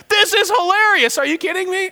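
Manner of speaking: hammed-up incredulous laugh-voice; mock laughing disbelief. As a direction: play Abram laughing at the idea. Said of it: "busting up laughing".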